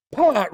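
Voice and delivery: a high, loud imitated pop. Imitating the popcorn on the cinema ride, loud high pop. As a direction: pop it out like a bursting kernel. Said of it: "really loud".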